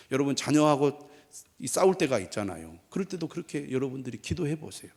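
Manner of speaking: usual speaking voice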